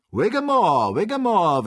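high, loud driving cry; merry shouting. A narrator's reading. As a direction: holler it gleefully to spur the animal. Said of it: "schrie der fröhliche Joe". (fröhlicher, lauter Anfeuerungsschrei)

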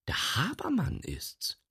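hushed high whisper. Acted. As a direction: whisper the name. Secret gossip in kitchen.

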